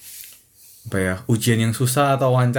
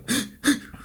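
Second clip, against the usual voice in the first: mock crying, quick high breathy gasps; imitated sobbing gasps; 11 semitones higher, 6 dB quieter than usual. Shown as a demonstration. Asking friend how hard they cried, imitated sobbing gasps. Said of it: "Nangis ini yang kayak".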